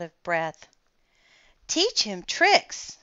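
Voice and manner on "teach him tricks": put-on high, slow eager child voice; children asking. A narrator's reading. Children beg for dinosaur tricks, high and slow. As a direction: pitch it up like a keen little kid. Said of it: "said the children".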